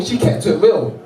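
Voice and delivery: normal talking voice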